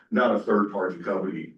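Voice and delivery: usual speaking voice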